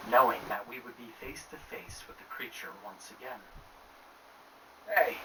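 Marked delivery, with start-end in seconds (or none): 4.9-5.2 high call from nearby. Acted. Lost man calling out into the corn maze, high call. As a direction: call out to find someone. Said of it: "a voice called out".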